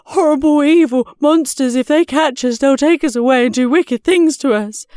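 high, loud panicky panting; panted terror. A narrator's reading. Grisby warns Rebecca of the monsters, panted in fright. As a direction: blurt it out in terror as you flee. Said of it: "panted Grisby".

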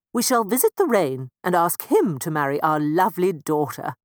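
a lightly voiced, firm mouse parent; determined mouse parent. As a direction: resolve to try the rain next. Parent decides next visit.

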